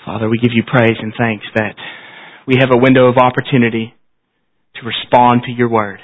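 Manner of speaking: prayer. Acted. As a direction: pray humbly to close the service. Closing prayer after "Let's pray.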